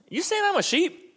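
high, offended double take; indignant squeak. Retold or imitated. Offended churchgoer hearing he is a sheep, squeaky and high.

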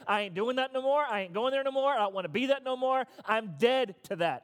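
fed-up, emphatic refusal; defiant attitude. Shown as a demonstration. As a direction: refuse with attitude, then stretch the last declaration. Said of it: "There's an attitude that says".

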